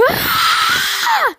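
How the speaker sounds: high, loud agonized scream; scream of pain. Acted. Wounded fighter losing an arm, screaming in pain. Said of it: "he screams out".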